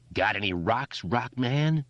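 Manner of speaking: high crazed taunt; raving bully. Acted. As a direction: rave down at him. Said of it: "Henry raved down at him".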